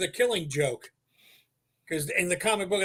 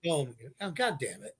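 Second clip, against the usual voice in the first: low, soft mock exasperation; imitating a quiet grumble to oneself; muted dismay; 6 semitones lower, 9 dB quieter than usual. Retelling reaction to the prop gun going pop, muted dismay.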